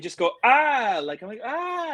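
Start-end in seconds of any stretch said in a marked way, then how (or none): 0.4-1.0 high, louder "ah" of alarm; mock panicked yelp; retold freak-out cry. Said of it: "I'm, like, freaking out". 1.4-1.9 very high drawn-out "ah"; thin mock scream of panic; retold freak-out cry. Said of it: "I'm, like, freaking out".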